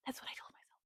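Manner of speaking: sharp gasp then hushed fast whisper; breathless whisper, sped up. Shown as a demonstration. Reader confessing in a gasping whisper.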